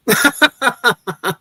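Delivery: high cackling laugh, imitating her; high mocking laugh of an old woman; mock old woman cackle. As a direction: cackle like the amused old lady. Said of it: "the old woman goes".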